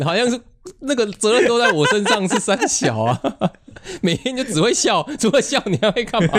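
laughing complaint. Real, not acted. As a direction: complain while cracking up. Laughing mock complaint to co-host.

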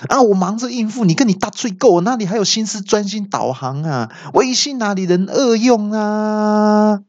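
high-pitched defensive protest; hammed-up indignant machine voice; stubborn retort. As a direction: argue back, wailing the last words. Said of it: "导航机这时候还嘴硬说".

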